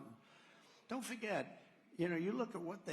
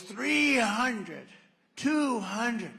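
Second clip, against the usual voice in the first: higher, louder and very slow machine countdown; slow computer callout; 7 semitones higher, 10 dB louder, much slower than usual. Retold or imitated. To the crowd, building tension with the plane's altitude computer. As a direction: drag the numbers out like a warning system. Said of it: "a computer voice".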